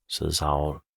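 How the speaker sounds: ordinary delivery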